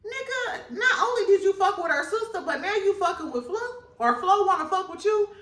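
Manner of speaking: high, fast outraged voice; high, fast mock outrage; imagined Amara. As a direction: confront him, incredulous. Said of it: "I'm sure Amara like".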